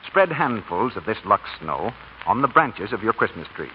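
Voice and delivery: usual speaking voice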